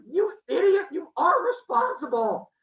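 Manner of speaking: high, loud furious yell; angry yell. Acted. Leto furious at Zeus for watching her suffer, yelling. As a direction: scream the blame right at him.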